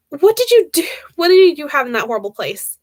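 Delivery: usual speaking voice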